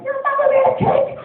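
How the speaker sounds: put-on high, loud furious child's yell; imitated screaming kid brother, half laughing; screaming tantrum. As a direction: scream it like a scared boy's tantrum. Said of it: "screaming and yelling".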